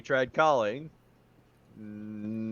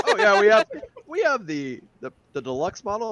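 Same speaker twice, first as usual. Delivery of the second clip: mock boastful Dave; 7 semitones higher, 5 dB louder than usual. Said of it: "You keep are like".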